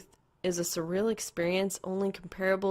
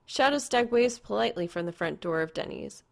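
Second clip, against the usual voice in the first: plain reading voice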